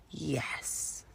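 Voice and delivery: breathy whispered yes. Real, not acted. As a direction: hush it out, pleased. Whispered self-affirmation.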